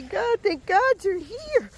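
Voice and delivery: lightly put-on high pet voice; playful mock relief for an animal; voicing the animal.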